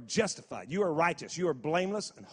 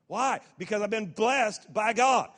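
rising, louder declaration of blessing; preaching at full force; 4 semitones higher, 3 dB louder than usual. Acted. Preacher building to the congregation, full force.

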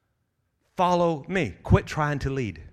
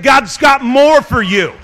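loud, higher shout, slow; shouted repeat; 6 semitones higher, 9 dB louder, slower than usual. Shown as a demonstration. Preacher repeating to the church, full shout. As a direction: call it out again, louder. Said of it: "I said".

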